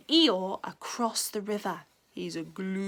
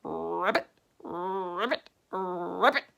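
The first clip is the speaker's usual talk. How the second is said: low, ghostly calling; eerie low calling; 4 semitones lower, slower than usual. A narrator's reading. Mysterious voice calling Rabbit in the mist, low and eerie. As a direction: moan the name spookily.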